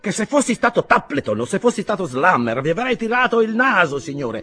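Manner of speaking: high, loud and hot-tempered; irascible. A narrator's reading. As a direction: bristle with challenge at the stranger. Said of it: "l'irascibile dottor Payne".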